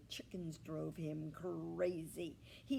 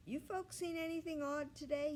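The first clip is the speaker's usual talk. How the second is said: higher pig voice, a touch louder; lightly voiced pig; 11 semitones higher, 4 dB louder than usual. A narrator's reading. Ernest the pig asks the chickens, lightly voiced. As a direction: ask like a wary pig.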